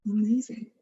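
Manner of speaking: ordinary delivery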